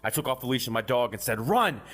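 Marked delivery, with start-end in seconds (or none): 1.5-1.8 sharp high shout to the dog; panicked shout of run; yelled command. Acted. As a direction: yell it as you bolt. Fleeing, yelling to dog.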